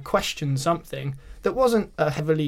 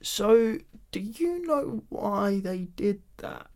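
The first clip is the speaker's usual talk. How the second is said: high, slow, careful question; awkward probing; 5 semitones higher, 3 dB quieter, much slower than usual. Retold or imitated. Man to his date over ice cream, awkwardly probing. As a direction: tiptoe into it, drawn out.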